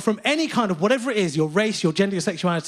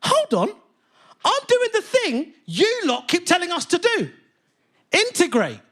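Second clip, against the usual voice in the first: high, rising incredulous indignation; comic rant at the heckler, indignant and high; indignant outrage; 12 semitones higher than usual. Retold or imitated. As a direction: let the outrage climb. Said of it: "I was like".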